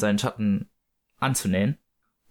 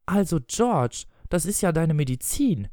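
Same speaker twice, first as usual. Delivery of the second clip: reproachful wife; 5 semitones higher than usual. Acted. Wife discovers husband's trick with the medicine, reproachful.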